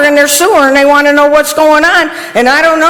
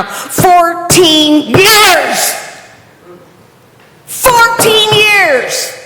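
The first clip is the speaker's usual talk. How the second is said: very slow, drawn out and raised; frustrated emphasis; 5 semitones higher, much slower than usual. Real, not acted. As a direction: drag out each word with pent-up anger. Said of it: "I am so frustrated right now".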